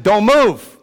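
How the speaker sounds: loud, emphatic retold warning; sharp acted order. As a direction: call it out like a dad ordering his kid to freeze. Acted order to frightened son.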